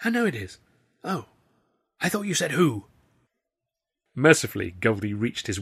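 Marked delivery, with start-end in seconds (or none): 0.0-2.8 hushed backstage voices. A narrator's reading. Hushed wings exchange.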